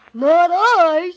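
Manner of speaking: slow, loud, dopey goose voice; silly goose. Acted. Goose refuses.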